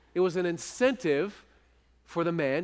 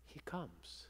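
whisper; 5 semitones lower, 20 dB quieter than usual. Acted. Preacher whispers the climax.

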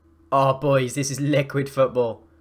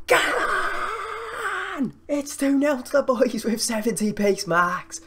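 long squealed cheer into high-pitched gloating; triumphant yell; 12 semitones higher, slower than usual. Real, not acted. Gamer celebrating going two nil up, triumphant. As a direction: scream it, then crow excitedly.

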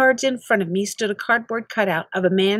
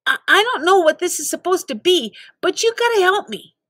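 desperate plea; 9 semitones higher than usual. Acted. Pleading with sergeant.

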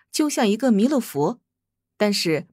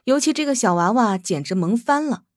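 plain reading voice

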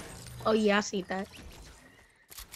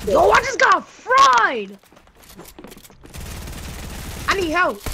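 calling from far away; 8 semitones higher than usual. Real, not acted. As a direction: shout it out, stunned. Just got knocked in game.

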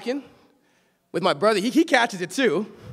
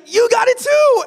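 higher, louder excited quote; brother's alarm; 14 semitones higher, 9 dB louder than usual. Retold or imitated. Brother noticing the pink eye, loud excited blurt. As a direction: blurt it out like a startled kid brother. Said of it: "And he's like".

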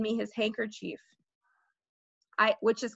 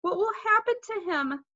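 higher, anxious question; mildly tearful worry; 8 semitones higher than usual. A narrator's reading. Sheila fears for injured Fudge, mildly tearful. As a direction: ask plaintively about the child. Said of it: "Sheila cried".

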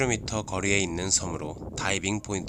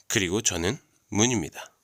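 ordinary delivery

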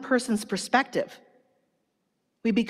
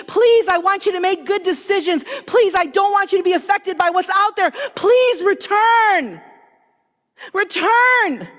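louder, higher grandmother begging her grandson, rising to a shout; pleading abuela; 7 semitones higher, 6 dB louder than usual. Retold or imitated. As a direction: plead with him like a worried grandmother. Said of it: "my abuela used to say".

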